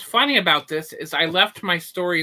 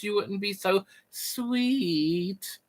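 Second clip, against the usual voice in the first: slow, teasing compliment; flirty sweet line; 4 semitones higher, much slower than usual. Pretend. Slowly flattering a viewer who loves sugar.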